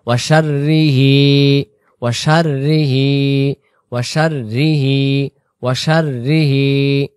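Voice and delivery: "ওয়াশাররিহি" with the র doubled and held, slow; doubled r recitation. Shown as a demonstration. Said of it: "এভাবে পড়ব". ("ওয়াশাররিহি"-তে র দ্বিত্ব করে পড়া)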